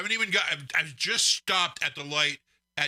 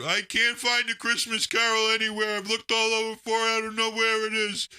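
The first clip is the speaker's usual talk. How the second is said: imitating panic; 6 semitones higher than usual. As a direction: ham up a flustered friend who lost the file. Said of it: "I'm freaking out".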